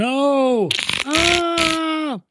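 mock scream. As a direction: squeal in mock terror. Scared by mock alien clicks.

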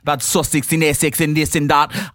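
put-on a British accent slipping into Russian; a British-Russian crossover accent; British accent turning Russian. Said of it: "That's Russian".

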